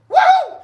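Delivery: very high, loud whoop; celebratory woo. Real, not acted. Host cheers for his newbies, high whoop.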